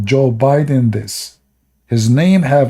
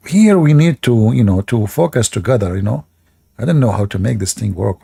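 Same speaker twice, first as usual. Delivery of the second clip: ordinary delivery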